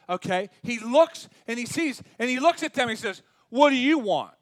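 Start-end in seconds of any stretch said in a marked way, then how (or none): 3.5-4.3 loud, challenging demand; what-do-you-want, thrown out loud; blunt, brusque demand. Acted. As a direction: snap it out like an irritated challenge. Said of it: "he didn't really say it like that".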